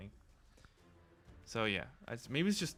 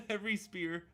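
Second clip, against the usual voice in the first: laughing; 8 semitones higher than usual. Real, not acted. Laughs while reading.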